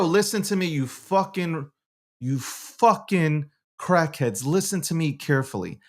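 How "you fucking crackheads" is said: ordinary delivery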